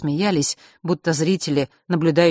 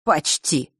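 smirking. Acted. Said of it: "усмехнулся тот".

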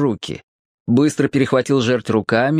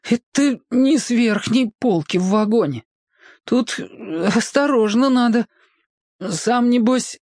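put-on higher boyish inner voice; boy thinking; 10 semitones higher than usual. A narrator's reading. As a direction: Petka reasoning nervously. Boy's inner thought. (высокий мальчишеский голос, с опаской)

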